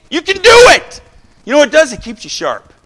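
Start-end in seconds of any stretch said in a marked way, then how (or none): 0.1-1.0 high, loud cheering shout; coaching yell. Real, not acted. 1.9-2.7 quietly explaining; quiet take. Shown as a demonstration. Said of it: "You don't have to yell like me".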